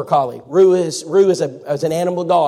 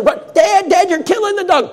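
put-on high, loud child voices crying out; kids pleading; 5 dB louder than usual. Kids begging their dad to stop, high panicked pleading.

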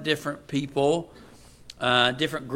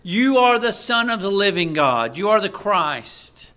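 raised, loud preacher's voice building to Peter's answer; preaching at full force; 5 semitones higher, 8 dB louder than usual. Acted. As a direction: proclaim it from the pulpit.

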